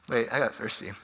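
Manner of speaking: low, barely voiced aside; hushed aside. Real, not acted. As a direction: drop to a near-whisper for a quick aside. Aside while pausing to drink.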